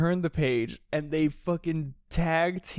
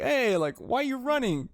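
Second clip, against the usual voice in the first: higher, calling out after someone; mock Zoro call; 8 semitones higher than usual. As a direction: call after the fleeing kid, puzzled. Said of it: "And Zoro's like".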